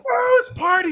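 high, loud party-guy voice; high coked-up neighbor. Retold or imitated. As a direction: mimic the wasted neighbor at the party. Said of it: "he's like".

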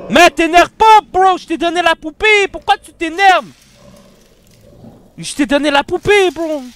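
loud, high frustrated yelling; exasperated shouting. Real, not acted. Yelling at the monster after giving it the doll, exasperated. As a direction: shout at it in disbelief.

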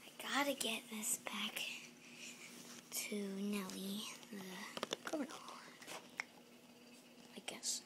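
very soft sneaky whisper. Acted.

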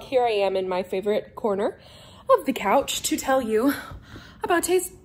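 ordinary talk

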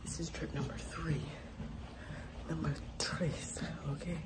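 hushed whisper of number tres. Real, not acted. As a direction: whisper it secretly. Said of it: "whispering on camera".

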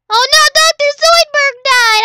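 high, loud mock panic; hammed-up oh no; mock alarm. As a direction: cry out in pretend horror. Mock alarm at game death.

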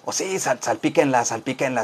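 sly aside. Pretend. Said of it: "mischievous joke, dropping voice".

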